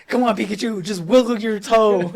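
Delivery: high laughing plea to a Pikachu; mock pleading.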